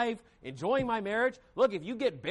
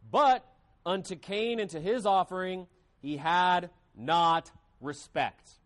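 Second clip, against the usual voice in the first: measured, lower scripture reading, slowing at the end; 4 semitones lower than usual. Acted. Preacher reading Genesis to the church, measured and solemn.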